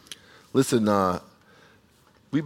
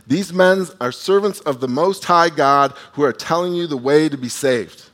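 loud, higher call after them in the street; retold shouting; 4 semitones higher, 7 dB louder than usual. Slave girl following Paul, shouting loudly. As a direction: cry it out after Paul, raised and insistent.

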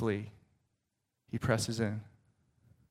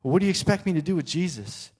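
a higher, quick-talking Pilate; Pilate questioning; 5 semitones higher than usual. Retold or imitated. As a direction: answer the mob as a puzzled governor. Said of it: "But Pilate says".

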